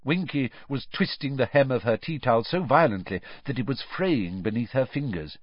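usual speaking voice

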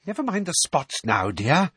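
high, brisk dismissal; thinly sweet impatience; impatient. A narrator's reading. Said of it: "said Umbridge impatiently".

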